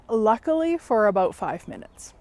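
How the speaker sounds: ordinary talk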